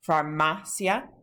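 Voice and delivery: Farmacia drawn out slowly, stress on MA; stress on second-to-last syllable. Shown as a demonstration. Teacher shows students the spoken accent, slow stress on MA in Farmacia. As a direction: slowly sound out far-MA-cia, leaning on MA. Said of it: "so the accent is on that".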